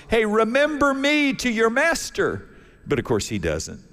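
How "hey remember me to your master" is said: hollering after him. Retold or imitated. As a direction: call out high across the prison yard. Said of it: "Joseph hollers".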